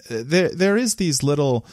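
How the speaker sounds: ordinary talk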